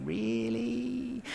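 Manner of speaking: high, doubtful "Really?"; a lightly mocked incredulous question; sceptical really. Co-founder doubting the levers idea, sceptical rising really. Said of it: "And he would say".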